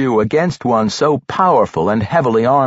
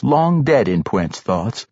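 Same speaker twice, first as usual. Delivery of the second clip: ordinary delivery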